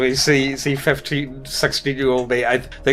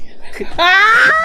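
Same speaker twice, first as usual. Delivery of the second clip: high shrieking burst of laughter; loud shrieking laugh; 5 dB louder than usual. Real, not acted. Laughing at dying pigeon story.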